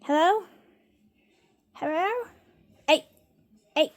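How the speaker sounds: high, rising hello; pitched well up with a questioning lift; calling for attention. Real, not acted. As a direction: call questioningly to a pet. Trying to get cat's attention.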